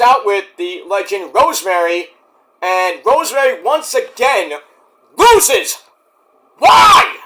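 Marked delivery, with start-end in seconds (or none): 5.2-7.2 high, loud cry of disbelief; outraged shout. Real, not acted. Fan protesting Rosemary's loss, outraged shout. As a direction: burst out in exasperation, climbing to a yell. Outraged at favourite wrestler losing.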